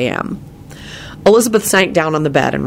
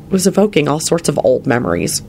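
ordinary delivery

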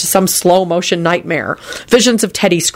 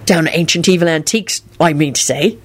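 ordinary delivery

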